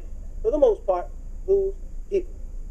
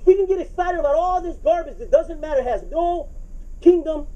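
too much caffeine; 6 semitones higher than usual. Real, not acted. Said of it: "I'm wired a whole different way".

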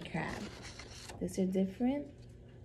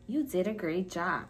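normal talking voice

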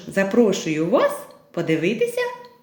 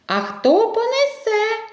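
questioning rooster; 13 semitones higher than usual. Acted. Rooster asks who will carry.

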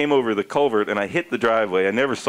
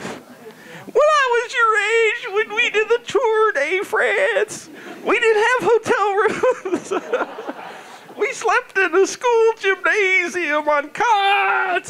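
put-on high, straining old-man rant; ranting granddad; slower than usual. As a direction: lecture the youngsters in a cranky high voice. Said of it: "I feel like your granddad".

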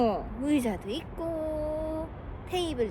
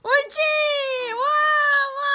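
high, loud delighted cheer; excited cheering; 11 semitones higher, 11 dB louder than usual. Real, not acted. Mother cheering baby's success.

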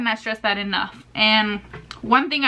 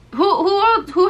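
excited; 8 semitones higher, 4 dB louder than usual. Real, not acted. Calling out to viewers.

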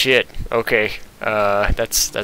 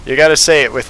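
ordinary talk

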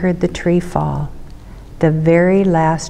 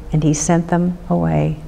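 ordinary delivery, nothing marked

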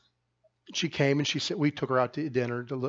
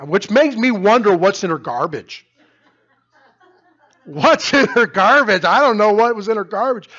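much louder, higher, amused outburst; loud comic bewilderment; 7 semitones higher, 11 dB louder than usual. Real, not acted. Pastor joking to congregation about her garbage, loud comic bewilderment. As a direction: burst out in raised, incredulous amusement.

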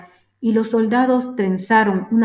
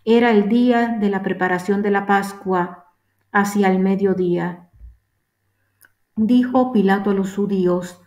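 plain reading voice

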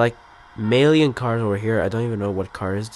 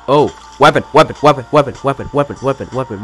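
loud burst that fades as it repeats; excited repeating; 2 semitones higher, 8 dB louder, faster than usual. Real, not acted. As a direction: rattle it off eagerly, over and over. Spots a weapon, repeats it excitedly.

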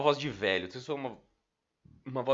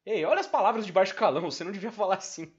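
excited; 10 semitones higher, 7 dB louder than usual. Real, not acted. Scolding the game character.

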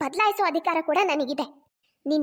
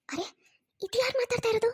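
hushed whisper; 5 semitones higher than usual. Acted. Mother whispers on the phone.

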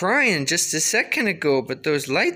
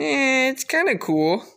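higher, lightly voiced baby thoughts; unsure baby voice, a notch higher; voicing the baby; 9 semitones higher than usual. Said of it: "He's thinking".

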